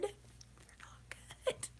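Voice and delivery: high, slow whispered complaint; hushed high whine about the cookies; whispered disgust. Acted. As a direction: whisper it like a sad confession.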